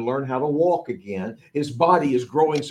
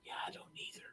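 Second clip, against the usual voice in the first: whisper; 26 dB quieter, much faster than usual. Retold or imitated. Disciples whispering by the fire.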